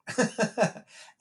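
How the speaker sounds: high bright laugh; burst of laughter. Real, not acted. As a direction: laugh out loud at the idea. Laughing at own point.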